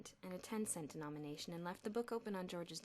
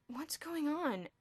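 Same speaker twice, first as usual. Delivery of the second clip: slow, higher sleepy question; 8 semitones higher, 5 dB louder, much slower than usual. A narrator's reading. Boy woken in room two eleven, sleepy. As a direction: sound just woken. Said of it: "asked a sleepy voice".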